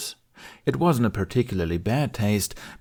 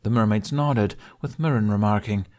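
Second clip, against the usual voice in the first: ordinary delivery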